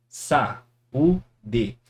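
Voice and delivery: "Sa-u-de" with a clear break between each syllable; split into syllables. Shown as a demonstration. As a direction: tap out "Sa-u-de" slowly in three beats. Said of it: "Mais uma vez". ("Sa-u-de" separado sílaba por sílaba)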